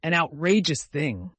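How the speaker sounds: loud outrage, repeated for relief; emphatic indignant outburst; violent indignation. A narrator's reading. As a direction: vent it, hitting "outrageous" hard. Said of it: "said Uncle Benjamin violently".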